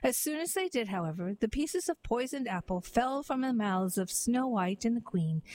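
plain reading voice

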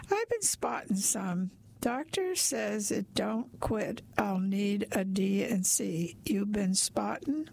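ordinary delivery

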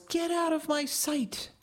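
high shrieking yell. Acted. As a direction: shriek it at the sons. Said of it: "and yells".

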